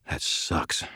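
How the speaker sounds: near-whispered, soft; hushed dismay. A narrator's reading. Harry shuddering to Ebenezer, hushed dismay. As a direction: breathe it out in horror.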